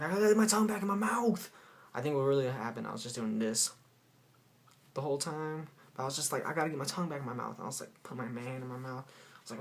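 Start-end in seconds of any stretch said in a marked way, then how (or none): 0.0-1.5 loud, high, hammed-up alarm; mock panicked yell. Storyteller acting out his tongue flopping out, mock panicked yell. 6.5-7.5 rattled off fast; quick retold worry. Storyteller explaining what really happened, quick retold worry. Retells the same line more quickly.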